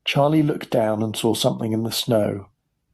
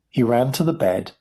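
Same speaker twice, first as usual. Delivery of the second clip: usual speaking voice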